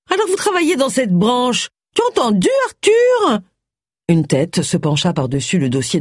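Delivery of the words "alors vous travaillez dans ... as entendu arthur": high, nagging upstart wife; shrill Missus Toynbee. A narrator's reading. Missus Toynbee calling to her husband, high and nagging. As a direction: call across the room to the husband, high and shrill.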